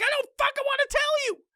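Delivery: high squeaky mock whine; mock whiny reply. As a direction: whine back in a silly high voice. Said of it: "And I'm like".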